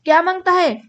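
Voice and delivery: restrained cross call-out; mild irritated yell. A narrator's reading. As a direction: snap the question with some irritation. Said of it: "चीड पसरवून तो पुन्हा ओरडला".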